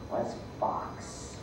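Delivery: dropped to a near whisper; whispered dramatic reveal; hushed reveal. A narrator's reading. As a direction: let the name land in a hush.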